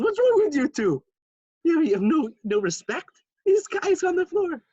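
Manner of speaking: very high, loud mock outrage; outraged scolding. Rebbe scolding two friends dancing beside a sobbing man, outraged. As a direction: squawk a telling-off, voice shooting way up.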